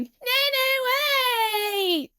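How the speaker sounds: high, long-held call; pleading shout. A narrator's reading. Emu chasing fleeing Nene, shouting after her. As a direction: call after them high, stretching the last word. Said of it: "Emu's shouting".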